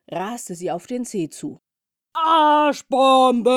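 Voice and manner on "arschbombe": battle cry. Acted. Bumblebee dives at the cat.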